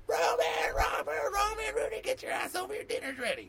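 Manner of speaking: very high-pitched imitation of a mother hollering; shrill mock mom summons to dinner; mom calling out. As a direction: screech the kids' names across the neighborhood. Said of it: "My mom comes out and goes".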